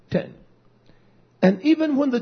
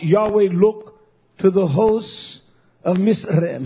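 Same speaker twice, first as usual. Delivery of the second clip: usual speaking voice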